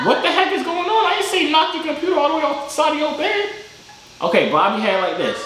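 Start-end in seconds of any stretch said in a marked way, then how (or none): 0.0-3.5 mock shock. Real, not acted. Mock shock at knocked computer.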